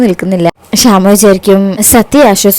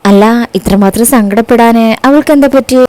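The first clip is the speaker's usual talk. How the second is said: ordinary delivery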